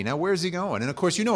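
ordinary delivery

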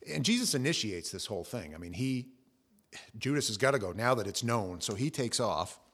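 usual speaking voice

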